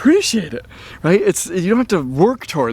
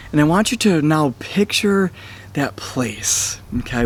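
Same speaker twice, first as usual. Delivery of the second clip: calm meditative tone; 3 dB quieter than usual. Acted. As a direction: ease them into the chair and breathe. Leads relaxation and visualization.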